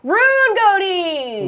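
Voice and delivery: slow, excited announcement of a name; drawn-out winner announcement. Real, not acted. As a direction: announce it like a game-show winner.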